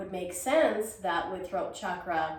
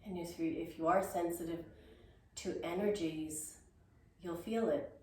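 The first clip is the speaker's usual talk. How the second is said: soft guided meditation; 2 semitones lower, 9 dB quieter than usual. Acted. Guided meditation close of exercise.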